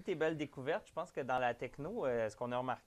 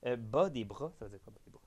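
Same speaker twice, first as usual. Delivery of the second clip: ordinary delivery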